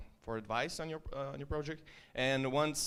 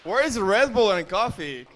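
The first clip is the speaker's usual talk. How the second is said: loud joking call; 8 semitones higher, 11 dB louder than usual. Real, not acted. Joking loudly to crowd.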